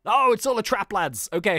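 normal talking voice

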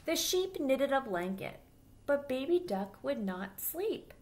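put-on lively storybook voice for little kids; reading to children. A narrator's reading. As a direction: read it aloud like storytime for children. Reads picture book to children.

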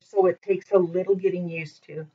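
ordinary talk, nothing marked

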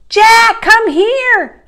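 very high, loud summons; calling out. A narrator's reading. Annie summoning Jack over the hill, high loud call. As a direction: holler for someone to come. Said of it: "Annie called".

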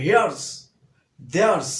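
loud "here's", "there's" ending in z; drilling the contractions loudly. Shown as a demonstration. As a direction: punch "here's" and "there's", finish on z. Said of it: "এর উচ্চারণটা জ".

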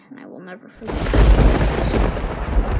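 slow, breathy whisper full of memory; hushed whisper. Acted. Captain telling his crewman of that stormy night, whispering slowly. Captain recalling friend's warning.

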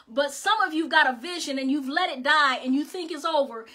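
ordinary talk

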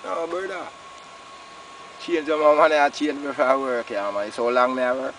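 ordinary talk

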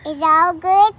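loud, eager echo of the phrase; loud repeat. Pretend. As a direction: shout the question back. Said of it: "Tell her".

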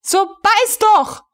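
high, slow, shrill-free screaming command; shout. A narrator's reading. Frau von Melin screaming at the frozen recruit, furious. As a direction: scream the order at him. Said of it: "schrie Frau von Melin".

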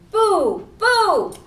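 spooky pumpkin boo, rising loud. Acted. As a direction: shout the boo to scare the kids. Scary pumpkin head goes boo.

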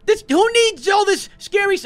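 high, loud frightened squeaking; scared squeal. Real, not acted. Gamer spooked by a horror game, scared high squeal. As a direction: panicky and high, half-shouting. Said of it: "I'm a little scared".

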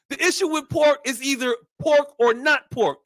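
normal talking voice

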